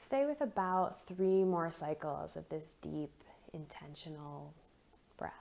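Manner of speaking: soft, unhurried meditation voice with long pauses; guided meditation. Acted. Leads a guided breathing meditation.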